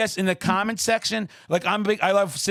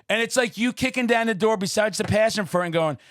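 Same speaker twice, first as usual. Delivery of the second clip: ordinary talk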